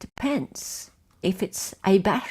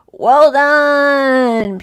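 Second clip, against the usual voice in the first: loud, drawn-out cheer; congratulating; 3 semitones higher, 9 dB louder than usual. Real, not acted. Host praises the quiz players, congratulating. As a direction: stretch it out in praise.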